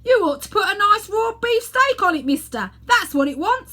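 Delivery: high, loud cheeky lad; butcher boy. Acted. Butcher boy advising the fat man, cheeky and loud. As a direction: call out helpful advice. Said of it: "said a butcher boy".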